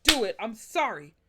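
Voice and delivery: worked-up, dramatic refusal; retold pissed-off rant. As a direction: apologize while still fed up. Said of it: "how pissed I was".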